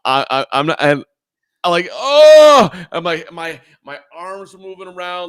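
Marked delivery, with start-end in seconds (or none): 1.9-2.7 long, high, loud "oh" of pain; reenacted pained yell, high and very loud. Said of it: "I was yelling out in pain".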